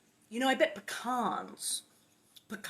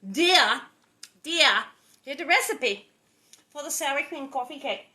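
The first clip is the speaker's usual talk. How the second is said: quoting Missus Phelan; 4 semitones higher, 6 dB louder than usual. Retold or imitated. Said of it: "when she said".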